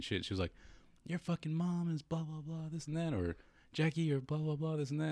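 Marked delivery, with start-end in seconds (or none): 1.1-3.1 high, slowed imitation of a nagging woman; mock high-voiced tirade; mock trash-talker. Imitating neighbour girl talking trash. 3.7-5.1 high, slowed imitation of a nagging woman; mock high-voiced tirade; mock trash-talker. Imitating neighbour girl talking trash.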